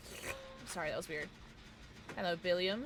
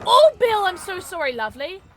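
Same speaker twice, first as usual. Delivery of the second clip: loud apologetic cry; 8 semitones higher, 14 dB louder than usual. Real, not acted.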